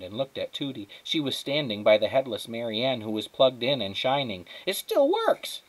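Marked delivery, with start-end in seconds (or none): 4.7-5.6 very high, loud, gleeful squeal; thrilled. Acted. Tootie sees the broken lamp shining, thrilled. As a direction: marvel at it at the top of the voice.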